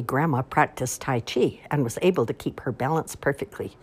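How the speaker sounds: plain reading voice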